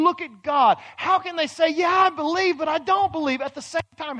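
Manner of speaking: high, loud preaching; preaching at full force. Acted. Preacher challenges the congregation, loud and high. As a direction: drive the questions home from the pulpit.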